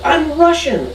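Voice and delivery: high-pitched, thrilled announcement; excited kid. Retold or imitated. Dad retelling his son's DNA-result reaction, mock excited. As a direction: imitate a kid proudly announcing big news. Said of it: "like, very excited".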